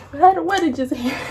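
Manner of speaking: high, quick whine; pouty high-pitched protest; mock whining. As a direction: whine it like a protesting kid, half laughing. Re-enacts whiny protest, laughing.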